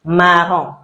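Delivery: "marron" said slowly as mah-ROH, nasal end, no n; slow French marron. Shown as a demonstration. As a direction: draw out "marron" and drop the final n. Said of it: "We don't pronounce the N at the end".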